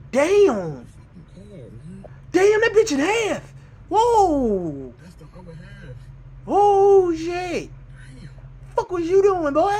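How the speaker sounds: shocked exclamations. Real, not acted. Viewer reacts to a car torn in half, stunned and loud. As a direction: blurt it out in disbelief.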